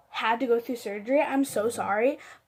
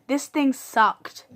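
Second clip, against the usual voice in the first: ordinary delivery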